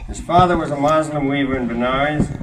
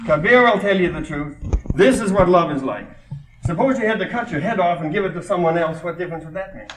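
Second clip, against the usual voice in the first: higher, declaimed poetry reading; poem recitation; 5 semitones higher, 3 dB louder than usual. Acted. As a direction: proclaim the verse to the listeners. Continues reading the poem aloud.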